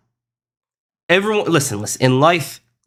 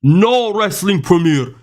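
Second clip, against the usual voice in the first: slow, higher-pitched giant impression; Andre the Giant; 6 semitones higher, much slower than usual. Streamer attempting a requested wrestler impression, slow put-on giant voice. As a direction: stretch each word out slowly in an attempted French accent. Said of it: "can you do an impression of Andre the Giant's".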